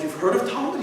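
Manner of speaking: high, surprised you've heard; surprised question. Retold or imitated. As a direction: ask in disbelief. Chinese partner amazed.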